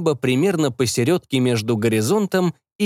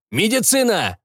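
calling out; 9 semitones higher than usual. Acted. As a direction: call it out across the room. Shouted call.

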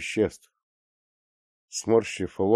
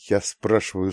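ordinary delivery

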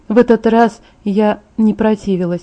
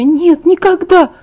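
higher, slow tearful cry; fighting tears; 9 semitones higher, 4 dB louder, slower than usual. Acted. Bella, fighting tears, denies it to Jacob tearfully. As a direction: push the words out through tears. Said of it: "сражаясь со слезами".

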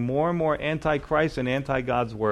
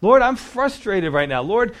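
raised, louder mock complaint to God; a hint of frustrated pleading; honest angry prayer; 6 semitones higher, 5 dB louder than usual. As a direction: vent it to the Lord, lifted and pushed. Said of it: "it's okay to say".

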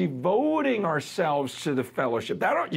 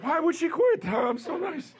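mock innocence; 13 semitones higher than usual. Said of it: "I'm like".

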